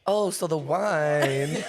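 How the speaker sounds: drawn-out mom impression. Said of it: "she's like".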